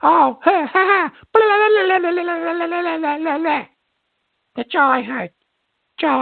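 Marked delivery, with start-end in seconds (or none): 0.0-3.8 rapid run-on mock babble; mocking gibberish imitation. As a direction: mimic the caller as meaningless babble. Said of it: "It sounded like he said".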